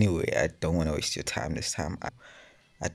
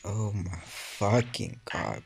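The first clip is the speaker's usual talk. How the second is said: normal talking voice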